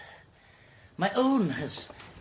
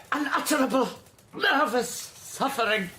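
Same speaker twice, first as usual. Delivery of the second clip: higher, laughing through it, slowed at the end; nervous giggle of suffering; overwrought; 8 semitones higher than usual. Acted. Invalid recounting his torment to the visitor, with a nervous laugh.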